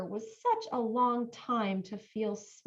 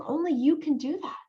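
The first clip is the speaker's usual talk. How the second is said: usual speaking voice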